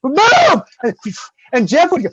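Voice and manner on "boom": high, loud impact yell; shouted boom. Retold or imitated. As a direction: shout the hit. Impact sound effect.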